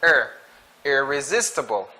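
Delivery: ordinary talk, nothing marked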